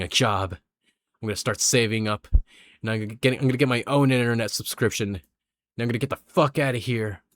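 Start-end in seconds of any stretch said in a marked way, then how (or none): none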